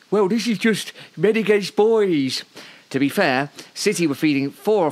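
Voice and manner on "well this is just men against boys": put-on high, slow Birmingham accent; a weary Brummie grumbling, Brummie accent. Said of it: "which is always an excuse for an accent".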